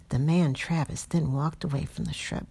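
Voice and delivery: ordinary delivery, nothing marked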